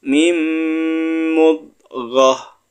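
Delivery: chanted Arabic with tajwid; drawn-out Arabic recitation; Quran recitation. Shown as a demonstration. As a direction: recite it slowly like a Quran teacher. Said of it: "Kita baca bareng-bareng".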